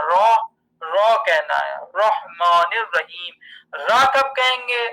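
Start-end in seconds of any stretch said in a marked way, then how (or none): 0.0-1.2 را thick and rounded, "raw"; heavy ra. Shown as a demonstration. Teacher insisting on thick reading, را as "raw". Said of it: "موٹا کر کے پڑھنا ہے". 1.9-3.3 slow رحمان الرحیم with "roh"; heavy ra. Shown as a demonstration. Teacher repeating the right reading, slow heavy رحمان الرحیم. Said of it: "موٹا کر کے پڑھنا ہے".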